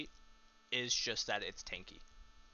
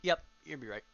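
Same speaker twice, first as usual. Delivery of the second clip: talking through a mouthful; words round a bite of food; mouth full; 3 semitones higher, 4 dB louder, faster than usual. Real, not acted. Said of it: "Sorry, I took a bite".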